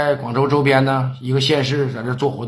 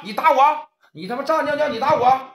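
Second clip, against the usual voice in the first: angry taunt; 8 semitones higher than usual. Acted. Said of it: "叫板".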